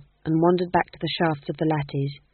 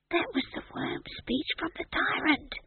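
hushed and awed, softer; whispered; 11 semitones higher, 8 dB quieter than usual. A narrator's reading. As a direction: breathe it out in awe. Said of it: "whispered Howell".

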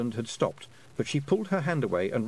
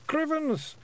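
tiny pictsie voice. A narrator's reading.

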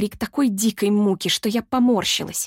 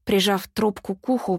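plain reading voice

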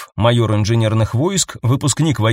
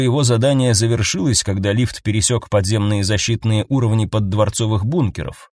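ordinary delivery

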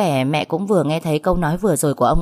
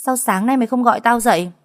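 shout; 6 semitones higher, 3 dB louder than usual. A narrator's reading. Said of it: "Nó hét lên".

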